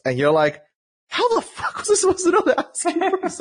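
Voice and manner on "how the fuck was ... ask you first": very high-pitched, incredulous protest; exasperated outburst. Retold or imitated. Player answering developers' telling-off, acted exasperation. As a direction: protest in a high squeal of disbelief. Acting out exasperated reply to devs.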